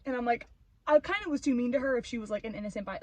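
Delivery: normal talking voice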